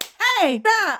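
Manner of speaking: high, loud, beaming ad-man greeting; cheesy commercial voice. Pretend. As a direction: sell it like a corny worldwide TV ad. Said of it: "ridiculous commercials that are, like, really cheesy".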